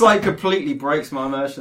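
ordinary delivery

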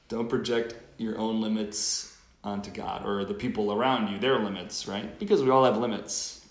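ordinary talk, nothing marked

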